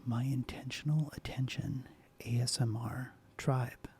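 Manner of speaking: soft ASMR whisper; soft spoken voice. Real, not acted. ASMR host welcoming her viewers, soft whisper. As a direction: hush it gently, close to the mic. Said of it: "to do this in a soft spoken voice".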